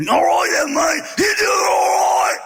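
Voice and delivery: high, loud, raspy Aussie shout; raspy Australian. Retold or imitated. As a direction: rasp it out loudly like a drunk Australian pirate. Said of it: "He had more of a raspy voice".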